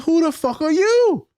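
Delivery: high, incredulous mock outrage; retelling a clash with a guard, high indignant; indignant outburst. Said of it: "I'm like".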